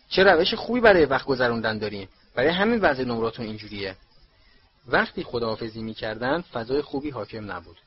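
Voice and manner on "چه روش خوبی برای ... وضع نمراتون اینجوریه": plain reading voice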